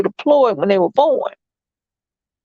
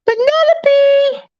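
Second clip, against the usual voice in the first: high, drawn-out sing-song call of the name; 14 semitones higher, much slower than usual. Real, not acted. Mom calls to baby Penelope in high sing-song.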